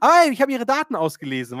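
euphoric. Retold or imitated. Doctor cheerfully announcing she has read the data, euphoric. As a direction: announce it like great news. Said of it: "War so voll euphorisch".

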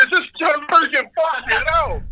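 mock amazement. Jokes it is Joe Rogan.

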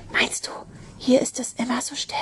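soft whispered question. A narrator's reading. Charlotte wonders about the stillness to Ben, whispering. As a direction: ask it in a hushed whisper. Hushed exchange on the silent lake.

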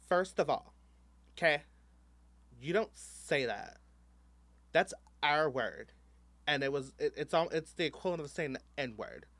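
loud, slow, offended lecture; indignant scolding. Retold or imitated. The offended queen telling him off, loud and slow. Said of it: "this queen was like".

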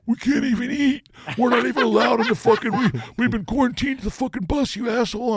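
high, loud mock whine; whiny bandmates. Bandmates griping about being quarantined to the bus, high mock whine.

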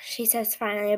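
ordinary delivery

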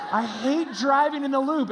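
high, loud exasperated complaint; stressed out. Retold or imitated. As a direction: vent your dread of the drive. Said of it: "I'm getting all stressed out".

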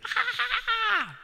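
sudden high falsetto shriek; a hammed-up high scream; mock scream. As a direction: squeal like a panicked neighbor. Imitating neighbor's scream.

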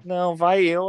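ordinary talk, nothing marked

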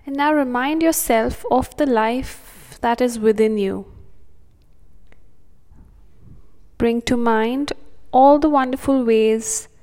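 soothing, unhurried breathing cues; calm meditative tone. Acted. Meditation continues.

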